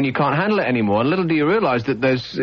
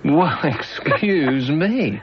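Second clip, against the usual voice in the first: drawn-out, laughing mock affront; exaggerated slow huffiness; mock indignation; much slower than usual. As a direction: stretch it out very slowly, playing offended with a laugh. Mock offence at being corrected.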